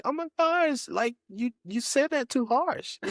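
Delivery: very high, whiny hurt voice; mock sensitive. New sensitive self complaining to wife about tone, high whine. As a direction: overplay being hurt and fragile. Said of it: "but now it's like".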